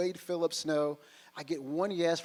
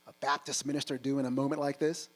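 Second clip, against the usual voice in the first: ordinary delivery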